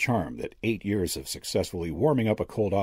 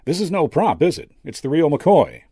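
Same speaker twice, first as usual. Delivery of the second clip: high, loud realization; brash magician; 9 semitones higher, 7 dB louder than usual. A narrator's reading. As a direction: blurt it out, pitched up. Magician startled by real skull.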